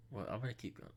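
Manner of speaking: plain reading voice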